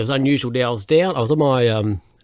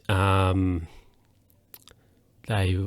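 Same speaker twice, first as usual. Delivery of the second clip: usual speaking voice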